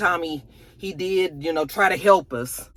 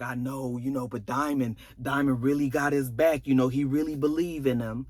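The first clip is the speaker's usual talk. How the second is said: lower, quieter man's voice; 5 semitones lower, 5 dB quieter than usual. Retold or imitated. Husband reassuring his wife, lower man's voice. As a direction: drop into a man's easy reassuring tone. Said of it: "He was just like".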